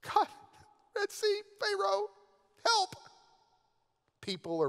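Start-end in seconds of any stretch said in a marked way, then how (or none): 0.0-3.0 much higher, slow, strained plea; desperate prayer. Retold or imitated. Moses praying to God in panic, high and strained. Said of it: "Moses is praying".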